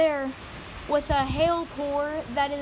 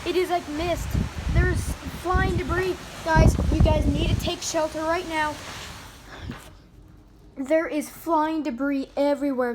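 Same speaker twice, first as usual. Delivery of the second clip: raised, urgent storm warning; urgent alarm; 3 semitones higher than usual. Real, not acted. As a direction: warn viewers to take shelter now. Debris flying, urging shelter.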